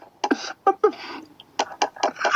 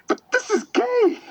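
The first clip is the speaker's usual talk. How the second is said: high, raised exasperated protest; exasperated outburst. Real, not acted. Defending his comment to angry commenters, exasperated and high. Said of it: "I'm like".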